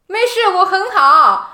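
put-on slow, high, loud old-man voice; grandpa's calm answer. Imitating the delirious grandpa telling the nurse he's fine.

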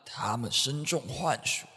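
ordinary talk, nothing marked

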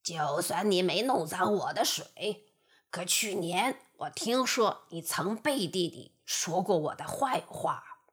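low wolf voice twisting the facts; wolf forcing an excuse. Acted. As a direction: bluster out a lame excuse. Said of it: "只好强词夺理地说". (强词夺理的狼)

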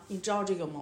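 ordinary talk

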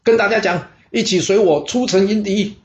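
rally the men with a shout; shouted order. A narrator's reading. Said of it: "叫身边的随从大叫说". (高声大叫)

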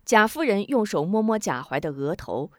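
ordinary delivery, nothing marked

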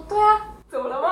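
mock-innocent high question; innocent reply. Retelling own answer to angry mom, mock innocent. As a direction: play dumb, all innocence. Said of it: "还一脸无辜".

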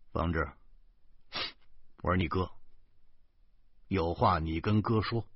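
放低声音温柔地劝; 像哥哥哄弟弟那样轻声说. A narrator's reading. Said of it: "我温柔地说". (哥哥温柔地劝慰弟弟)

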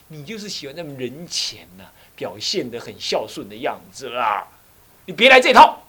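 mock a master snapping at a fawning disciple; retold scolding. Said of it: "他就骂我".

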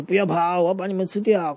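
更高更响地威胁大喊; 边追边吼要吃掉他们; 追赶的迅猛龙吼叫. Acted. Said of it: "迅猛龙就追在后面，就说". (追在后面的迅猛龙大声吼叫)

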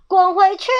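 loud, high angry shout; furious storybook outburst; scolding. A narrator's reading. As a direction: roar the intruder away. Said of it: "长耳骂他".

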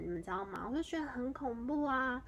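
usual speaking voice

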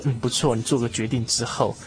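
ordinary talk

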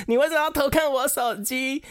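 high-pitched retold accusation; mock-outraged, voice jumping way up; indignant outburst. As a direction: imitate a boyfriend angrily turning the blame round. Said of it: "恼羞成怒".

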